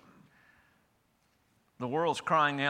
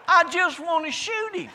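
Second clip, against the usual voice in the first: hammed-up outburst, pitched way up; mock outburst; 14 semitones higher, 8 dB louder than usual. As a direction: repeat it much higher and louder in mock fury. Repeated punchline, much higher, louder.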